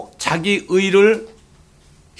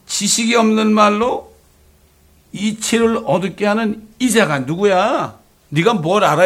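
raised, stern divine rebuke, higher pitch; God rebuking; 5 semitones higher than usual. Acted. God rebukes Job from the storm, stern and raised. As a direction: thunder down at Job from the whirlwind.

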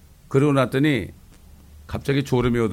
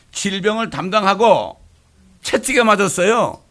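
raised, loud preaching cadence; full-force preaching; 7 semitones higher, 6 dB louder than usual. Acted. Pastor exhorting the congregation, loud and fervent. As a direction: preach it out to the whole church.

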